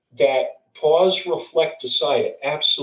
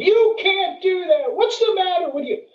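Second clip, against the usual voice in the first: mock indignant yelling, pitched way up; mimicked passenger outrage. Passenger berating a gate agent over a cancelled flight, mock outrage.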